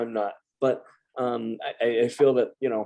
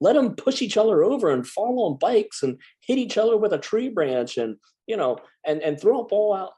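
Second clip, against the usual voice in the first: higher, mock-earnest tough-love rant; sarcastic reply; 6 semitones higher than usual. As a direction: play up the let-them-get-hurt bit, tongue in cheek. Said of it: "I'm like".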